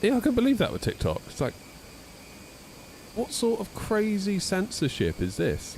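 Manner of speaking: outraged at the censorship; indignant disbelief; incredulous. Real, not acted. Venting about TikTok.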